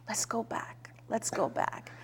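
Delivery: near-whispered, retold homesick plea; hushed plea. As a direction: plead very softly, as if confiding.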